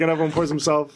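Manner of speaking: ordinary talk, nothing marked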